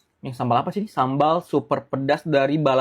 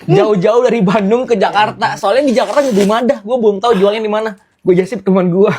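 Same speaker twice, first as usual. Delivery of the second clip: excited vlogger; 6 semitones higher, 8 dB louder than usual. Real, not acted. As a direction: sell it big to the camera. Loud excited vlog intro.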